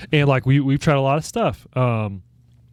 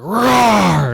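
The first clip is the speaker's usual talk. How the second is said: put-on loud, higher kid's roar; big loud roar; 9 semitones higher, 7 dB louder than usual. Son finally roars at the wall, loud and high. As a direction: let the "Rawr" out with everything. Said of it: "we gotta be really loud".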